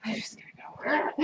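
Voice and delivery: whisper. Real, not acted. Wife trying to slip away.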